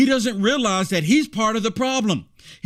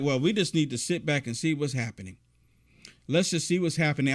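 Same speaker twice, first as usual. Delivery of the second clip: complacent wait-and-see; 8 semitones lower, 7 dB quieter than usual. Retold or imitated. Mocking complacent politicians' wait-and-see line.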